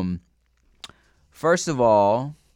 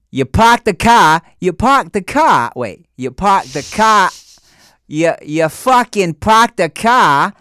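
loud, high British-Boston mash-up as Australian; Australian accent attempt; 8 semitones higher, 9 dB louder, slower than usual. Shown as a demonstration. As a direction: belt out a bad Aussie accent. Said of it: "British mixed with Boston".

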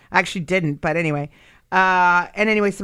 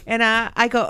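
ordinary talk

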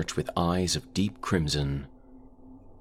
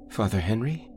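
higher, puzzled rising call; uncertain question; 7 semitones higher than usual. Acted. Narrator calling the priest who walked past, high and unsure. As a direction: ask his name, suddenly unsure.

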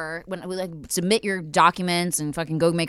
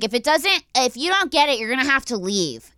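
high, loud mocking clerk threat; rude clerk; 7 semitones higher, 4 dB louder than usual. Mocking the clerk threatening to throw her out, high. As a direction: threaten like a snooty clerk, pitched up. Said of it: "And she's like".

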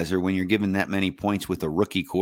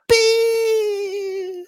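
very high beep; mock bleep. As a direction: censor bleep noise. Censor bleep imitation.

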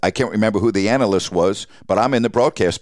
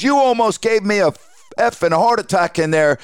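much higher, half-scolding; raised voice; 10 semitones higher than usual. Retold or imitated. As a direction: producer venting after the broadcast, raised voice. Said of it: "And he's raising his voice".

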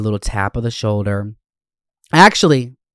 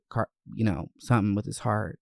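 ordinary talk

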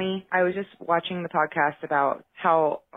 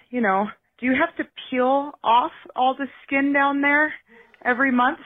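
put-on higher, innocent child's question; little daughter asking; 7 semitones higher than usual. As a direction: play the worried eight-year-old, pitched up. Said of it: "and she goes".

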